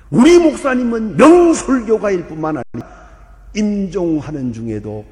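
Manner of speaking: proclaiming the elder's words loudly, like a funeral eulogy; loud eulogy quote. Retold or imitated. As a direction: boom it out as the elder did at the funeral. (장례식 추도사처럼 크게 외치듯)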